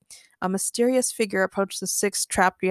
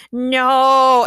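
loud robotic shout; all together, a flat loud no; 4 semitones higher, 9 dB louder than usual. Acted. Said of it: "robots all shouted".

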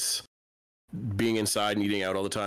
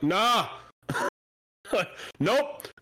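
mocking refusal; 10 semitones higher than usual. Driver turning down a wave to pass, gleeful refusal. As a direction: refuse it point-blank, amused.

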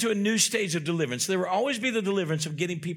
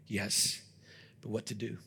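usual speaking voice

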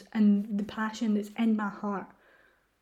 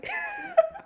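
high-pitched emotional laugh. Real, not acted. Podcaster about to announce her news, laughing high. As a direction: laugh at being overcome.

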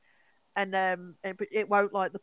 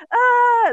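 sharp high scream, very loud; retold scream; 15 dB louder than usual. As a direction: shriek it out in panic. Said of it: "all you hear in this jungle is".

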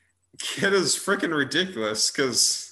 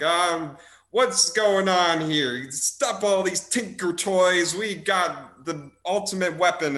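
put-on Southern cowboy accent; 5 semitones higher than usual. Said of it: "a Western cowboy Southern accent".

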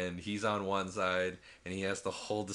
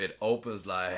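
ordinary talk

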